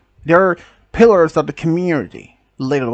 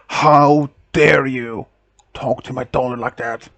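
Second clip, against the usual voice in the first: retold booming voice; 2 semitones lower than usual. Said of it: "his booming voice".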